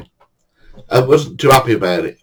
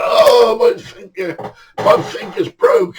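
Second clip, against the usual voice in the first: very high crying whine, drawn out; hammed-up sobbing moan of pain; mock agonised wail; slower than usual. Victor standing hurt on the jetty, mock wailing.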